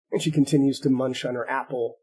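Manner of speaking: normal talking voice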